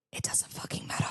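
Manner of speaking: whispered, emphatic aside; hushed emphasis. Real, not acted. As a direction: drop to a whisper to drive it home. Emphatic aside in advice to listeners.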